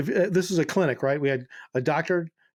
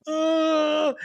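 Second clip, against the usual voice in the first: pained yelp; 12 semitones higher than usual. Retold or imitated. Friend hits head.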